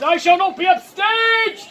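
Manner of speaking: slow, high indignant declaration; crying out. Acted. Brutus refusing to be outdone in death, indignant cry. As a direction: proclaim it grandly, stretching the last word. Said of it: "Brutus cries out".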